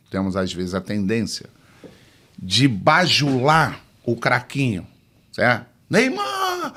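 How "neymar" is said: high, gushing call of Neymar; adoring coach calling Neymar; fawning call to the star. Retold or imitated. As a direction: gush over the star player's name. Coach fawning over star kid.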